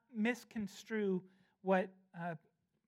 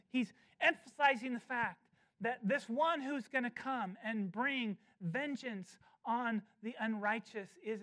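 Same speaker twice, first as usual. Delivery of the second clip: ordinary talk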